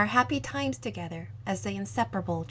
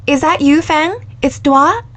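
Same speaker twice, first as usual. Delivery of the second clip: urgent phone call; 6 semitones higher, 12 dB louder than usual. Acted. Surprise late-night call from lost love.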